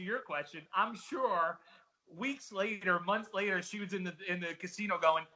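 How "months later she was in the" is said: usual speaking voice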